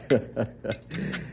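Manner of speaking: soft low chuckle; quiet chuckle. Acted. Colonel chuckles that things haven't changed, quiet chuckle. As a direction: chuckle to yourself.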